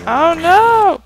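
sudden high-pitched "oh no"; startled cry. Real, not acted. Gamer seeing the trap fail, a high startled yelp. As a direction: yelp in alarm as something goes wrong.

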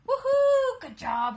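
high, loud woohoo; excited cheer. Real, not acted. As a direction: cheer her on loudly. Dog climbs trampoline.

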